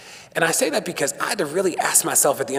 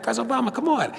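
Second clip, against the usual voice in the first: higher, teasing exasperation; playful chiding; 10 semitones higher, faster than usual. Retold or imitated. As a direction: needle him, half laughing. Chiding the president in retelling.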